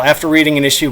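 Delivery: ordinary talk, nothing marked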